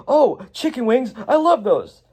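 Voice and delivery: high, eager gushing voice; high delighted gush; cheerful Emmett. Acted. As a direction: squeal it up high like a happy kid. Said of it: "then he's like".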